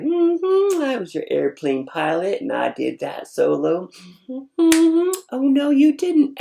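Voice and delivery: high, loud sassy bragging; cocky attitude. Retold or imitated. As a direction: show off with sassy high-pitched mm-hmms.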